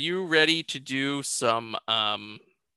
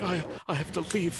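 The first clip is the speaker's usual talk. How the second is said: softer, quick, stammered imitation; David Attenborough; 3 semitones higher, 6 dB quieter, faster than usual. Imitating the actor in the scene, weak stammered mimicry. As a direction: mimic a faint, stricken man. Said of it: "He actually sounds like David Attenborough".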